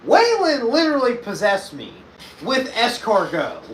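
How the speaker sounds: high, loud protest; worked-up, high-pitched outburst; exaggerated indignation. Real, not acted. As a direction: insist loudly, stretching the last words. Mock-outraged defence of the bit.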